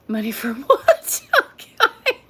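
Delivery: words broken by real laughter; laughing. Real, not acted. Laughing at a viewer's joke, real laughter. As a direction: laugh through the question.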